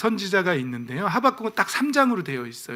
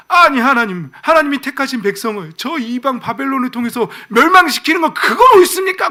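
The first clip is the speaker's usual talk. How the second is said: high, loud challenge rising to a cry; indignant protest; 7 semitones higher, 8 dB louder than usual. Acted. Habakkuk challenges God over Babylon, indignant protest. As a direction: argue back, building to an outraged question. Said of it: "또 막 항변하는 거예요".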